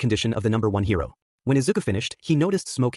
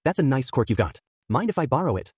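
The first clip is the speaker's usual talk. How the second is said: usual speaking voice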